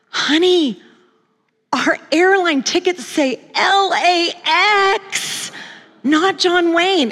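high, slow, drawn-out imitation of bad news; dismayed husband. Husband tells wife about the tickets, hammed-up slow dismay. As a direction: spell out the disaster slowly, high-pitched.

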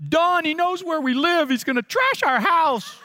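lightly voiced fearful older woman; worried mother-in-law. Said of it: "my mother-in-law says".

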